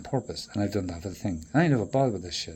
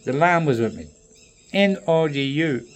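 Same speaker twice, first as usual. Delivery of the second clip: ordinary talk, nothing marked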